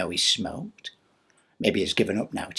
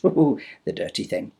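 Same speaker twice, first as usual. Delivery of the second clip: plain reading voice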